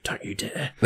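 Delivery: very soft imitated warning; low-volume mock telling-off under the breath; hushed warning. Imitating Catherine stopping him yelling, hushed warning.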